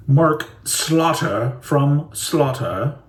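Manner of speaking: drawn-out posh British introduction. Shown as a demonstration. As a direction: announce it like a posh Brit. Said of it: "that was like posh British".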